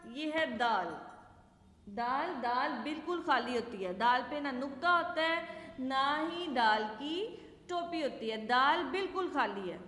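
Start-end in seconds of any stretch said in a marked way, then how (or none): none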